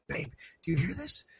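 hushed plea not to wake the thing; whispering urgently to his sleeping girlfriend. Retold or imitated. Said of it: "and I said".

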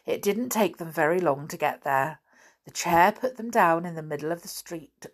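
ordinary delivery, nothing marked

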